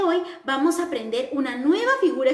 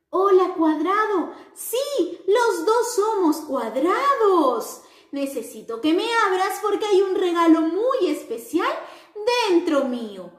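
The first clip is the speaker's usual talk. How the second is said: high, bright-eyed little square voice; sing-song greeting for children; cheerful square character; 4 semitones higher than usual. Acted. The square happily greets its friends in a high cheerful voice.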